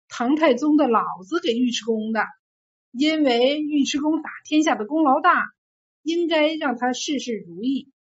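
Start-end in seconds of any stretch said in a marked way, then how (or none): none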